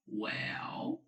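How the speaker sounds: "Well" drawn out low, dipping then rising; hesitating well. Shown as a demonstration. Teacher models a hesitating "Well" for third tone, drawn-out dip and rise. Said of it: "When you're hesitating".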